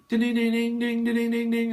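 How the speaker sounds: long run of high ringing dings on one note; high, insistent ding-ding-ding; imitated bell. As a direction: mimic someone hammering a service bell. Impatient guest ringing the bell.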